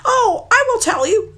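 a little bird begging; mud hen. Acted. Mud hen pleading with Maui, high bird voice.

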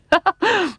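high, bubbling laugh; helpless little burst of mirth; acted chuckle. A narrator's reading. Uncle laughing in the air, high chuckle.

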